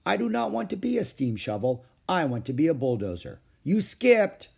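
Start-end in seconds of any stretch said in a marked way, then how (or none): 0.1-1.9 high-pitched storybook character voice; lightly voiced, higher little machine; storybook steam shovel. A narrator's reading. Beezus reading Scoopy's wish aloud, lightly voiced in higher pitch. 3.6-4.5 high, loud accusation; interrupting objection. A narrator's reading. Ramona catching her sister skipping a word, loud interrupting objection. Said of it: "interrupted Ramona".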